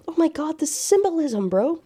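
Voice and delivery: high, thrilled gush; fangirl squeal. Real, not acted. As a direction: swoon over the symbolism. Fangirling over the symbolism.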